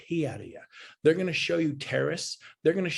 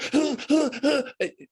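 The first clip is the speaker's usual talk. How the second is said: high, hammed-up gasp of outrage; theatrical high gasp; mock gasp; 11 semitones higher, 4 dB louder than usual. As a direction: gasp like a scandalised old man. Miming dad fired up.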